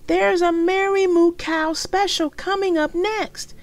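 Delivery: put-on a young girl bubbling over; high excited little girl; little sister. Little sister voiced in story.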